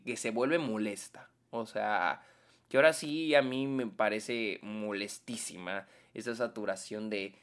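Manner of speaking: usual speaking voice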